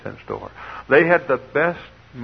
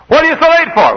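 high, loud imitated mother's yell; scolding mother; 12 dB louder than usual. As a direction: shout it as an exasperated mom. Mother repeats scolding, retold.

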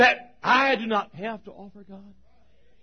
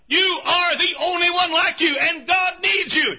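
preaching shout; 8 semitones higher than usual. Retold or imitated. Pastor recalling what he shouts from the pulpit, rising preaching shout. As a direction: hammer the line out like a revival call. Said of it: "if I'd say something like".

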